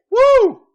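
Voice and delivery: very loud, high whoop; piercing acted whoop. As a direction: let out a sudden loud woo. Character whoops in delight.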